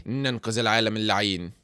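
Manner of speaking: ordinary delivery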